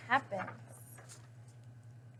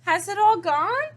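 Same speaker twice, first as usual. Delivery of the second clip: higher, louder hopeful ask; bright hopeful little question, higher and louder; 8 semitones higher, 6 dB louder than usual. A narrator's reading. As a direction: ask hoping it all fell off. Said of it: "she asked hopefully".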